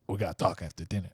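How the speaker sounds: lightly voiced low, quick tough guy; a hint of a menacing Scot. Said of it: "he doesn't talk like that".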